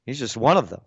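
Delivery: ordinary talk, nothing marked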